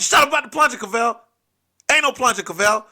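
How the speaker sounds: mock tough big brother; angry hush. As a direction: snap at him to shut up. Scolding panicking brother.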